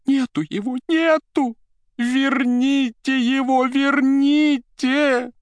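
high, drawn-out hysterical pleading; distraught wail. A narrator's reading. Secretary begs for her vanished boss back, wailing. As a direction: wail it, stretching the last words. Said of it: "ломая руки, вскричала".

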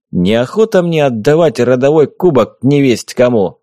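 ordinary delivery, nothing marked

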